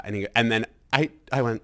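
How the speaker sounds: ordinary talk, nothing marked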